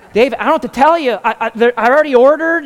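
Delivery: high, loud and fast protest; pleading it's too late; panicking to his brother; desperate. Retold or imitated.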